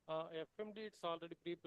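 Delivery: faint voice over a weak line; hardly heard through the call; barely audible. Real, not acted. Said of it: "Voice is not coming".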